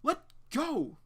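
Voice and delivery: a sharp high cry to break free; high shout. Acted. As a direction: yell it high while pulling loose. Claire struggling, hair grabbed.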